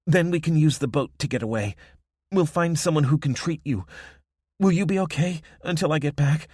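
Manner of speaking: high, loud worried woman; anxious and urgent. Acted.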